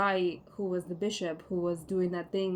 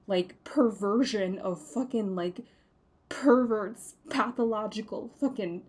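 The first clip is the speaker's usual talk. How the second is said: furious rant. Real, not acted. Furious rant about abusive bishop.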